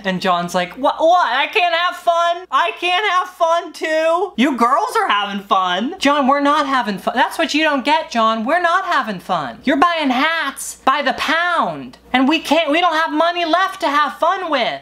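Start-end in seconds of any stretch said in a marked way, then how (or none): 0.7-6.0 higher, slower whine of a hurt husband; mock defensive husband. John whines to his wife about his hats, higher and slower. 6.0-14.8 higher, exasperated wife scolding; mock scolding wife. Wife scolds John for buying hats by the pound, in a higher exasperated voice.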